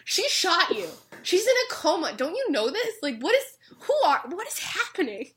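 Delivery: disbelieving, voice up high; bewildered. Retold or imitated. Narrator reacting to Pegasus's shooting, incredulous and high. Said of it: "And I'm like".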